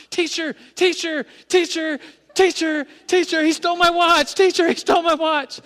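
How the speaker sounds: put-on high, loud child's wail building up; calling teacher. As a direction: yell for the teacher like a desperate kid.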